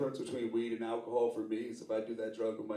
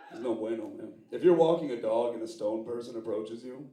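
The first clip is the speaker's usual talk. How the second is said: usual speaking voice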